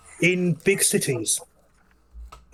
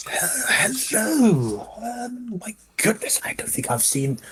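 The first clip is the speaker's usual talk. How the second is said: high, fussy shopkeeper voice; a chirpy, eager-to-please goblin tailor greeting customers; high-pitched welcoming merchant, a bit flustered; 6 semitones higher than usual. Acted. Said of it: "comes round, around the side and say".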